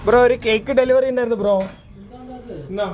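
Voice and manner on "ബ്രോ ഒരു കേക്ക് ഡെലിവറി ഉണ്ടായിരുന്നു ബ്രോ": higher-pitched delivery call. Pretend. Friend arrives announcing a cake delivery, calling out. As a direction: call out like a courier at the door.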